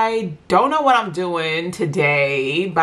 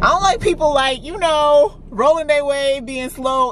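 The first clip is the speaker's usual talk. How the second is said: indignant rant; 9 semitones higher than usual. Real, not acted. Annoyed at slow drivers.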